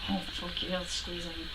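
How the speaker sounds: very soft, savoring aside; retold quiet relish of a hug; hushed delight. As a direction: murmur it secretly thrilled. Retells private delight at hug.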